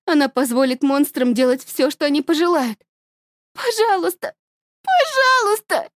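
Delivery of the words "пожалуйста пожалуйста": desperate pleading. A narrator's reading. Girl begs her mother, desperate and high. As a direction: plead for mercy.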